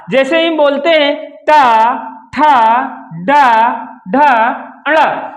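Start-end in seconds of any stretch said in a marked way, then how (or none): 1.3-5.3 "डा, ढा" drawn out slowly with the tongue tip up; slow retroflex demo. Shown as a demonstration. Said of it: "तो देखते हैं". (मूर्धा से धीरे-धीरे)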